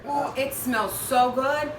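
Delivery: slow, delighted sniff; savoring a smell. Real, not acted. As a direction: breathe it in, pleased. Smelling the coffee.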